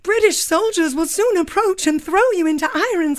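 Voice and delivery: high, melodramatic romance heroine; Claire. Acted. Claire fears soldiers will seize Jamie, melodramatic heroine. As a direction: plead with overblown passion. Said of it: "we wrote this sketch based on Outlander".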